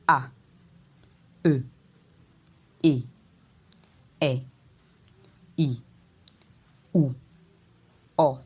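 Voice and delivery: each vowel "A, E, E, E, I, O, O" short and falling; low tone. Shown as a demonstration. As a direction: drop the pitch on every isolated vowel. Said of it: "on va descendre avec l'intonation".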